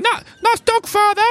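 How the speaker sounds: put-on high, loud street kid voice; street urchin. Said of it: "street urchins run over and they say".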